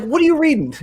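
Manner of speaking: mock the snooping question lightly; quoting the attorney. Attorney catching her reading, lightly voiced question. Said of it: "he was like".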